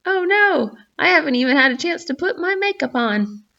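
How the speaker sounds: high, fussy mountain voice. Acted. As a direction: squeal it out like a fretting mountain. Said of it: "It's my mountain voice".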